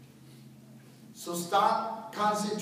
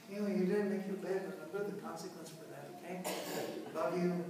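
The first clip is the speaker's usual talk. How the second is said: softer, quick, even and firm; calm authority; 4 semitones lower, 10 dB quieter, faster than usual. Shown as a demonstration. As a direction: lay down the rule kindly, then love you. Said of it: "you're gonna be talking like this because you're authority".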